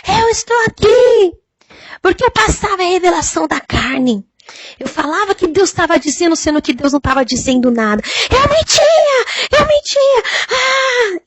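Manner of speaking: shrieking Eu mentia in anguish; terrified screaming. Retold or imitated. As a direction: cry it out like someone burning. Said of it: "ela gritava assim".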